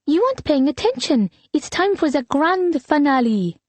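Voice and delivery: high-pitched little sister voice; chirpy little girl. A narrator's reading. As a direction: voice an eager child, pitched high. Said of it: "Liliane chirped".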